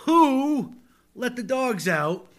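slow, deadpan recitation of lyrics; spoken-word reading of a pop hook; dramatic reading. Shown as a demonstration. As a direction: read the lyric like solemn poetry. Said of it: "as I read them aloud to you".

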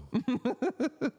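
embarrassed high giggle. Real, not acted. Embarrassed laugh.

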